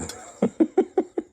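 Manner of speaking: giggling after the everything bite; high giggle. Real, not acted. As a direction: let out a high delighted giggle. High laugh.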